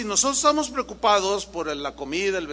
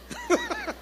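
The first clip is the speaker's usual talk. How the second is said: short laugh; 4 dB quieter than usual. Real, not acted. Laugh after joke.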